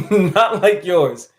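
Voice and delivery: slow, laughing through the words; giggling repeat, slowed down. Real, not acted. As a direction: chuckle it out slowly.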